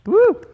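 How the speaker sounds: loud high cheering whoop; excited whoop. Real, not acted. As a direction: whoop to hype the crowd. Crowd cheer moment.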